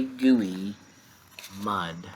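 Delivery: ordinary delivery, nothing marked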